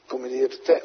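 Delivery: usual speaking voice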